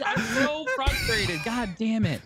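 high-pitched, drawn-out frustrated outburst. Retold or imitated. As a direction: whine it high and slow, exasperated. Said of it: "I'm very frustrated".

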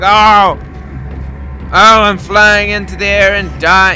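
mock dying monster. Player imitating a monster's silly death, loud mock wail. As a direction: ham up a ridiculous death cry. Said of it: "And then he's like".

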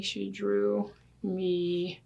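ordinary delivery

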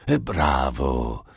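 È bravo lento, con È allungato e calante; beffardo. Acted. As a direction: voce diversa, lenta e canzonatoria su È bravo. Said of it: "gridò Iblis". (il diavolo deride Einstein, lento e beffardo)

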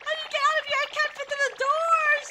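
high-pitched frantic escape cry; panicked. Pretend. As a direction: blurt it out, trapped and panicking. Trapped player fleeing bombs.

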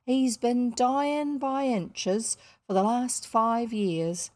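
blunt working-class housekeeper, lively rise and fall; emphatic working woman's voice with dropped letters; working-class Ellen. Acted. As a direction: scold and advise with unrefined vowels. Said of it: "she doesn't have refined vowels".

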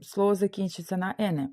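ordinary delivery

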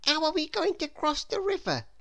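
put-on high young boy's voice; boy's worried question; Jack's voice. A narrator's reading. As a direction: ask it like an anxious small boy. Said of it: "Jack asked Mister Daydream".

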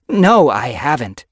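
loud, high, drawn-out denial; insistent. A narrator's reading. Yellowfang denying she threw her gift away, insistent. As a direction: deny it loudly, stretching the words. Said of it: "Yellowfang insisted".

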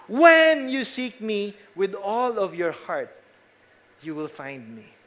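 "When" said loud and slow at the start; stress on when. Shown as a demonstration. Preacher demonstrating the verse with emphasis on when. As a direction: hit "When" first and hold it. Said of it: "put the emphasis on when".

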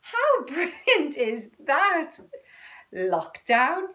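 loud, high and slow with laughs; delighted laughter. Real, not acted. Showing off the t-shirt Rachel made, laughing with delight. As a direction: gush over the gift.

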